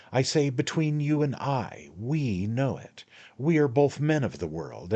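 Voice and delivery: high, wheedling man-of-the-world patter; a hint of a knowing little lawyer; sly attorney, coaxing. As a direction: draw him in like a confidant. The attorney coaxes Jingle.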